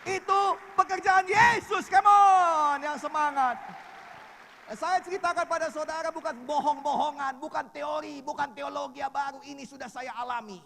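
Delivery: loud, high shouted preaching; full-force preaching. Acted. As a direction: rouse the congregation. (khotbah berapi-api)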